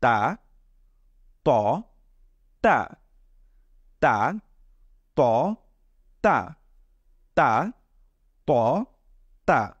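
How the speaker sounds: "তা", "ত", "তা" with tongue tip on upper teeth, spaced apart; tongue-tip Arabic letters. Shown as a demonstration. Teacher leads repeat-after-me drill, "তা", "ত" off the upper teeth. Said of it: "সেই দাঁতের পাটির সাথে আমাদের".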